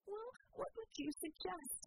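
a high, exasperated outburst; shouted. A narrator's reading. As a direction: snap back in angry fear. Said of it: "Vicky shouted".

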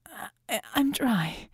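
ragged, exhausted voice; worn out and regretful. Acted. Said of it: "made her voice ragged".

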